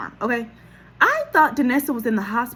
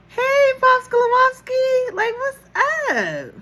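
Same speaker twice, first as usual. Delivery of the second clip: very high, drawn-out elated greeting; Disneyland happy; 6 dB louder than usual. Retold or imitated. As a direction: greet dad like you're at a theme park. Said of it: "Why does she sound like she's at Disneyland?".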